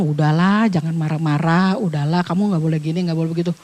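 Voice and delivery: low, soft soothing plea; soothing wife. Retold or imitated. Wife pleading with angry husband, low soft soothing. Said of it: "istrinya bilang".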